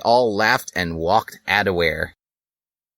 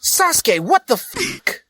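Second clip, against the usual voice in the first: much higher, drawn-out shocked cry; shocked outburst. Acted. Link catching his friends, a high shocked squeal. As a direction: squeal it in disbelief.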